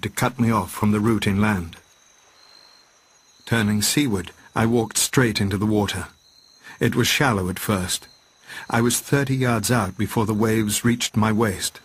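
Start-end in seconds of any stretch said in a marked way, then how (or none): none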